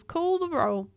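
storybook captain giving orders; captain's call. A narrator's reading. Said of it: "signalled Barnacles".

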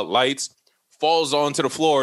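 ordinary talk, nothing marked

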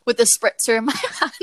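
words breaking into a real laugh; giggling as she gets to the hand. Real, not acted. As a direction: laugh through the end of the line. Embarrassed gym story, laughing.

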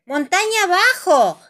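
grito agudo y fuerte. A narrator's reading. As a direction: grita como llamando a lo lejos. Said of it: "grita la señora Gacela". (exclamación gritada, en tono alto)

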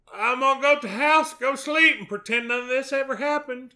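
high, loud spooked yelp; scared high voice. Retold or imitated. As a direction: squeal it like a spooked kid. Retold group panic quote.

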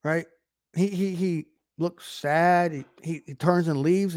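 ordinary talk, nothing marked

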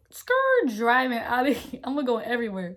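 excited. Retold or imitated. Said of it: "I said".

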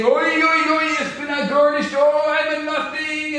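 high-pitched chanting wail; mock wailing scream. Second rabbinical student outdoing the first, mock wailing. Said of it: "And the other one saying".

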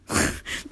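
quick unvoiced chuckle; a little embarrassed laugh to herself; short breathy laugh. Real, not acted. Laughs at own self-doubt.

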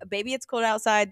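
normal talking voice

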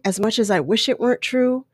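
ordinary talk, nothing marked

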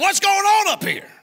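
high, loud confrontational demand; demanding shout. Retold or imitated. Church member leaning over the pastor's desk, loud demand. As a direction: lean in and bark the question.

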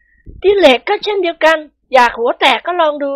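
high, slow feminine menace; threatening wife. A narrator's reading. Prapha menaces her husband with a club, threatening. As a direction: threaten him deliberately in a high voice. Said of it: "ประภาคุกคามสามีของหล่อน".

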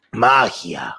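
wizard voice. Acted. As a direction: boom out the word like a warlock. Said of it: "yo seré un brujo". (voz de brujo gritando)

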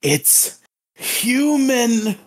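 high, slow hero war cry; anime battle cry. Pretend. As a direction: scream it like a final stand. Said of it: "and he's like".

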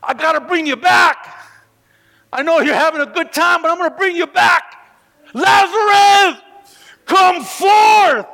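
high, loud pleading shout building to a command; Jesus raising Lazarus, loud and pleading; Jesus calling Lazarus. Acted. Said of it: "I can imagine".

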